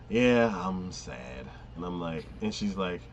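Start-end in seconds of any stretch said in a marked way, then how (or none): 0.1-1.5 drawn-out, mock-glum delivery; dragging the words like a sulky teen; slow mopey yeah I'm sad. Friend retelling a show's mopey hero, slow mock-sad. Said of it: "whatever he says is basically like".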